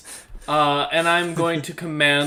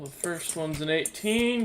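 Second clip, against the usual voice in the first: ordinary talk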